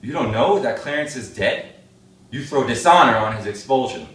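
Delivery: loud, heated demand; angry shout at the family; furious outburst. Acted. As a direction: blast them with outrage. Don furious at news of Clarence.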